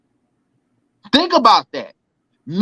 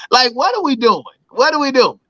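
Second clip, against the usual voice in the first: ordinary delivery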